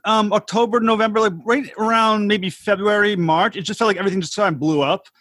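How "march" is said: ordinary talk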